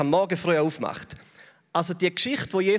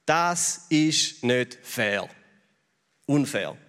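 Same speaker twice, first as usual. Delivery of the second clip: slowly sulking mantra; slow mock mantra; 6 semitones lower, much slower than usual. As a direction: drag it out very slowly, a little lower. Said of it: "Es gibt so die Frustmantras".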